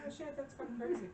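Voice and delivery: high, startled mock gasp of a voice; mock shocked. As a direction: play her shocked and wide-eyed. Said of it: "And she's like".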